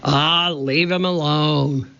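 lightly voiced pirate growling; gruff pirate growl. A narrator's reading. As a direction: snarl it like a deckhand. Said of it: "Pinky growled at Jack".

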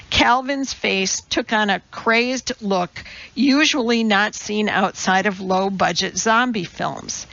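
ordinary delivery, nothing marked

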